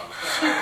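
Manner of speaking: a short burst of high laughter; sudden high laugh. Real, not acted. Author laughing at his own silly villain example. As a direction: break into a quick high laugh.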